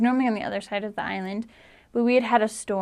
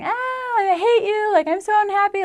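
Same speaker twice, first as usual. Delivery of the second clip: hammed-up child wailing, pitched way up; mock toddler tantrum; 11 semitones higher than usual. Little sister on the dock, imitated in a high mock wail. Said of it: "laying on the dock screaming".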